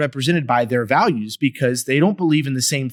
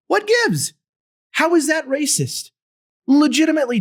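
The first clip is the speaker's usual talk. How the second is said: much higher, incredulous pitch; exasperated disbelief; 13 semitones higher than usual. Real, not acted. Host baffled by the racism charge, incredulous high voice. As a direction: throw up your hands in disbelief.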